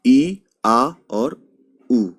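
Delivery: drawn out slowly, sound by sound; slow spelled-out vowels. Shown as a demonstration. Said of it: "उसकी जगह आता है".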